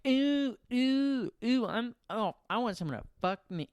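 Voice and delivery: high lustful moaning ooh; horny voice. Retold or imitated. Adult at home alone, high lustful moaning. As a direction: moan it like a needy adult. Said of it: "we go home and we're like".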